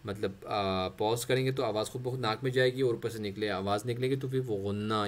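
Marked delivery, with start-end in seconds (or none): none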